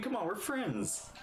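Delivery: normal talking voice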